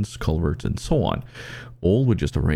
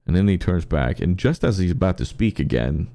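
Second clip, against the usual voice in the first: usual speaking voice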